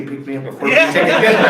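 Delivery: punchline dissolving into laughter; laughing. Real, not acted. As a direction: laugh your way through the last words.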